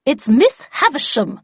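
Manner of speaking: vexed lady calling up to a guard. Acted. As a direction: announce it with loud annoyance. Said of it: "in a vexed tone".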